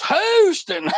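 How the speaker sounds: Houston said high in a put-on Texas drawl. The stranger answers the country guy in a Texas drawl. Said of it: "And the guy goes".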